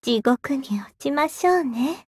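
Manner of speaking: close, hushed devil whispering; ASMR whisper. Acted. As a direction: whisper softly into the ear.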